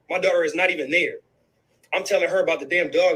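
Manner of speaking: ordinary delivery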